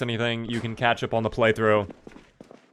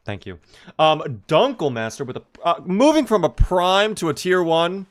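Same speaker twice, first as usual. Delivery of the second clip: ordinary talk, nothing marked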